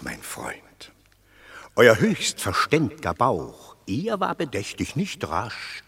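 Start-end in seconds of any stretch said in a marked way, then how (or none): 0.0-0.9 whisper. Acted. A patrician hushes the citizens before his fable, whispered.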